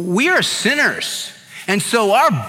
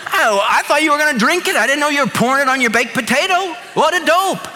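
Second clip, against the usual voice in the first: sheepish dad; 7 semitones higher, faster than usual. Retold or imitated. Dad's sheepish reply about juice.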